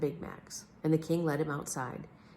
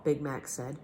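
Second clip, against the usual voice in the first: ordinary delivery, nothing marked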